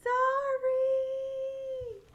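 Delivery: high, loud birdlike sorry; bird cry sorry. Acted. Little Bird squawking sorry to all his friends. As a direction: call it out like a bird.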